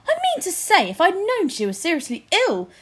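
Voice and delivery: panting king. Acted. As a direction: pant it out while being heaved into tight clothes. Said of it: "panted Fred".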